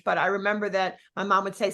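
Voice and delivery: normal talking voice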